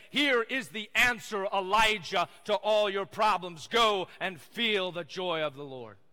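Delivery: loud, slow, booming proclamation; rumbling voice of God. Acted. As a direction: boom it out like God from an earthquake. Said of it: "in a rumbling voice".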